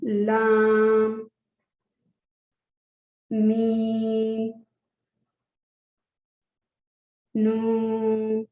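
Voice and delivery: लाम मीम नून each stretched slow and clear; drilled letters. Shown as a demonstration. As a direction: teach the letters slowly one by one. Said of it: "अच्छा, लाम". (हरूफ़ धीरे धीरे खींच कर पढ़ें)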